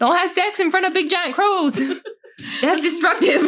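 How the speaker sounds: high, loud, laughing through it; laughing advice. Real, not acted. Laughing at friend over the crow card. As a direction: giggle out the silly warning.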